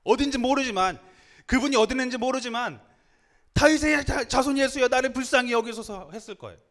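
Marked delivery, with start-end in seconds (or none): 0.0-2.8 raised, high preaching voice; urgent calling out. Acted. Builds toward the blind man's cry. 3.5-6.1 loud, high pleading shout; desperate outcry. Acted. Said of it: "바들바들 떨면서 외쳤을 거예요".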